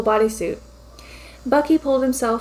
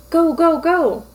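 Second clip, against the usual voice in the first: urgent shout; 5 semitones higher, 5 dB louder, slower than usual. A narrator's reading. Fleeing through the vent.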